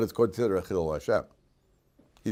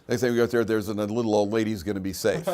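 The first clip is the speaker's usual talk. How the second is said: ordinary delivery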